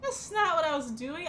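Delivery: sharp defensive denial; defensive outburst. Real, not acted. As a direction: insist you weren't stalling. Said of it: "There are no other jobs to distract us".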